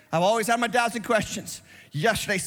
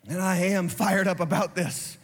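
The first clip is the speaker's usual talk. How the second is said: ordinary talk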